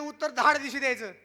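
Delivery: ordinary talk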